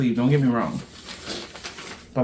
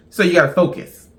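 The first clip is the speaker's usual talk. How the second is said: laughing burst, then a louder laughing line; 4 dB louder than usual. Real, not acted. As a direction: crack up, then push the words out through the laugh. Laughs about accent remark.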